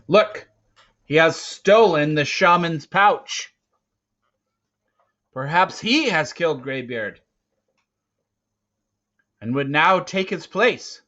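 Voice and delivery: high, loud triumphant accusing; loud accusation. Acted. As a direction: announce his crime to everyone. Hunter accuses boy before clan.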